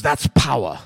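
put-on clipped German-accented that's power, low and dry. Quoting the drunk German officer in Schindler's List, German accent. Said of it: "It's a German accent".

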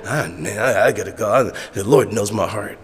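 low, soft sheepish excuse; half-hearted excuse. Acted. Backslider excusing himself from Bible reading, half-hearted. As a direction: beg off quietly, low in the voice.

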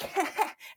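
high imitated nurse's laugh; short put-on cackle, pitched high; mock laugh.

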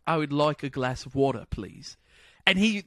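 convincing put-on British; British accent attempt. American copying friend's phrase on FaceTime, good British accent. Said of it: "I did it".

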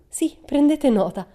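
high, loud, slow echo of the order; cheeky echo. A narrator's reading. Pippi echoing the teacher's order to the secretaries, cheeky. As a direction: throw her order back at her, cheeky and loud.

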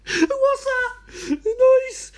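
very high, frightened cry; scared cry. Acted. Stranded host hearing a noise, frightened high cry. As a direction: yelp at a sound in the dark.

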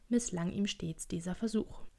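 plain reading voice

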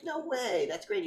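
high-pitched chummy reaction; acted-out friendly enthusiasm; mock delighted surprise. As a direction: imitate polite amazement. Acts out lawyer's friendly reply.

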